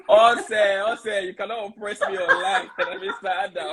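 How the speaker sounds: high, laughing mock protest; laughing banter. Real, not acted. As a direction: tease them through real laughter. Teasing a viewer while laughing.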